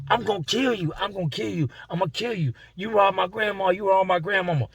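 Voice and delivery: high, heated threats; furious threat. Retold or imitated. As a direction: retell a man's rage. Enraged inmate pulled apart.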